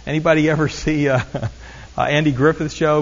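ordinary talk

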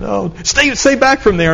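put-on high-pitched worried call to the kids; anxious warning. Mother calling her boys back from the canyon edge, alarmed.

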